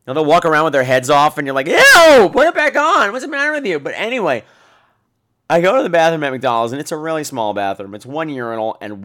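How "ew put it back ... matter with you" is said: grossed out. Retold or imitated. Disgusted at headless costume.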